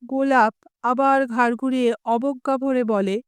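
usual speaking voice

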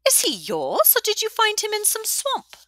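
high, scoffing tavern woman; mocking old widow. A narrator's reading. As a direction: poke fun at the scruffy boy. Widow mocks the boy.

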